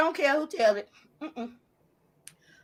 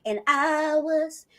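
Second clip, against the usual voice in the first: very slow, stretched, half-sung brag; lilting, softer, teasing sing-song; taunting drawn-out boast; 5 dB quieter, much slower than usual. Real, not acted. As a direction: draw it out as if it could go on forever. Taunts rival with her stamina.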